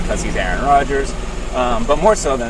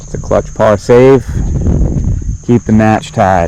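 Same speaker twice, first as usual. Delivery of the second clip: louder broadcaster call, slowing down; golf commentator; 5 dB louder than usual. Pretend. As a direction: announce the save like TV golf. Golf play-by-play after putt.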